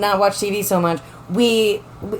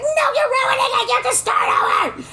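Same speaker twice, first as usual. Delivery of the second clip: high, shrill-free mock protest, way up; wife stopping a botched role play, mock outraged high protest.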